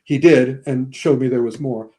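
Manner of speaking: normal talking voice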